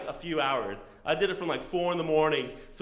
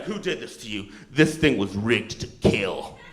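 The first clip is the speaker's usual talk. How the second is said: low verdict, slowing and louder at the end; grave mechanic; 7 semitones lower, 6 dB louder than usual. Retold or imitated. Mechanic warning rider about his bike, grave.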